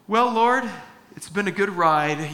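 high, loud mock goodbye to the Lord; half-joking surrender to fate; wry last words before going under; resigned farewell. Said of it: "I'm looking at the hole going".

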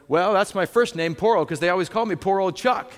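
lightly voiced old resident springing his pun; old man's joke. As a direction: deliver the joke with mock pride. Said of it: "And he goes".

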